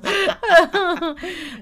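loud, high-pitched cackling laugh. Real, not acted. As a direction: burst into helpless laughter. Laughs at the friend.

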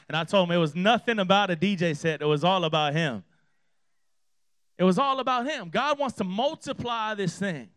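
loud, rousing sermon delivery; emphatic preaching. Real, not acted. Pastor urging the congregation to spread the gospel, emphatic preaching. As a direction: drive it home to the congregation.

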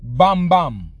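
put-on calling out in a light Caribbean accent; loud Caribbean greeting, name said twice; Caribbean neighbor voice. Neighbor calls out to him in a light Caribbean accent. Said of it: "he was like".

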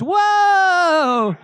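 put-on delighted kid shouting high; a drenched boy's thrilled yelp; kid's whoa. The boy squeals in delight after being doused at his baptism. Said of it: "the little kid goes".

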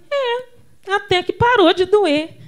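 put-on high, loud child's relief; relieved child. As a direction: play a kid once the pain stops. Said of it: "a gente falava assim". (criança aliviada, voz aguda)

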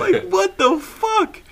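high, stretched out through laughter; laughing disbelief. Real, not acted. Friend baffled by uncle's stunt, laughing incredulously. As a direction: squeal it slowly, still cracking up. Cracking up after retold retort.

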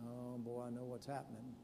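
softly voiced old preacher realising; hushed realisation. Old preacher realising what's happening, hushed and resigned.

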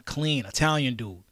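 usual speaking voice

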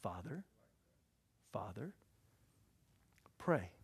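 soft, low call lifting at the end; repeated appeal. Acted. Believer persistently calling on the heavenly Father, soft rising appeal. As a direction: ask gently for attention, rising each time. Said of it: "our attitude ought to be".

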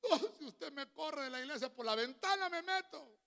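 high-pitched tearful imitation; mock crying. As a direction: plead in a hammed-up weepy voice. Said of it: "así llorando". (súplica llorosa fingida, en tono agudo)

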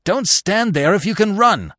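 high, loud warning cry; urgent call. A narrator's reading. Carus warns Bambi ahead of the din, urgent calling. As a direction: yell urgently to flee. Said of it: "he called when he saw Bambi".

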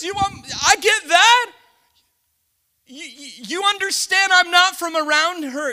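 loud, high, pleading foreigner's voice; Ruth pleading at full force. Retold or imitated. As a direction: cry out as Ruth, I don't belong here. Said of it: "She says".

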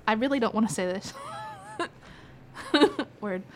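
laughing through the words; giggle, dreading the next word; embarrassed laughter in the voice; reluctant giggling. Real, not acted. Giggling before an awkward place name.

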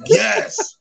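a small nervous yes, way up high; meek yes. Retold or imitated. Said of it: "I'm like".

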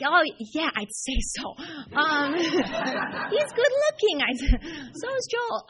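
giggling, high and loud; breaking into laughter through the words; laughing hard while recalling calling him good looking. Real, not acted. Giggling over the boyfriend's looks.

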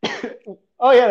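coughing between words; words broken by a cough; coughing fit. Real, not acted. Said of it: "sorry for my coughing".

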